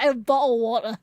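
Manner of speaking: a lightly put-on British "a bottle of water"; mock British accent. Shown as a demonstration. Streamer presents a British accent to chat, light. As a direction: show off a small British accent. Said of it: "There's my British".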